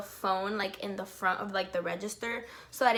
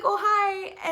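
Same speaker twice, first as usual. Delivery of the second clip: much higher, louder breathless greeting; giddy hi; 10 semitones higher, 6 dB louder than usual. Retold or imitated. Fan says hi to idol on call, giddy high voice. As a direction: gush a hello at your idol.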